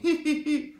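quick high-pitched chuckle; a brief amused cackle; short high laugh. A narrator's reading. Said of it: "lachte Sam Hawkins". (kurzes hohes Lachen)

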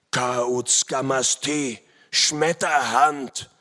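slow, drawn-out cry of recognition; loud startled call. A narrator's reading. Enemy warrior recognising Schmetterhand, slow loud call. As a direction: call the name out loud, very slowly. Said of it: "rief er laut".

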